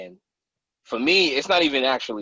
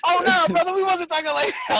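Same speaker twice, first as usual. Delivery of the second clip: much higher, louder mock apology; backpedaling buddy; 5 dB louder than usual. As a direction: play the embarrassed guy walking it back. Camp guys backpedal in story.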